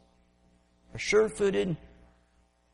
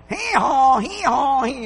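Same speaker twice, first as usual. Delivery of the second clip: loud high donkey bray; hee-hawing donkey; 8 semitones higher, 7 dB louder, slower than usual. Retold or imitated. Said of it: "he's going".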